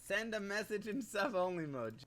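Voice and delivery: her own voice played back; quiet playback of her message; played back recording. Real, not acted. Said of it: "Is that how I sound?".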